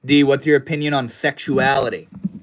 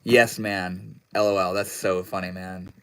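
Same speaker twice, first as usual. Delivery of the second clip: ordinary talk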